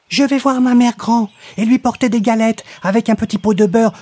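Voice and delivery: put-on high, quick, innocent child; little girl voice. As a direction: chirp it like a trusting little girl. Girl answers the wolf.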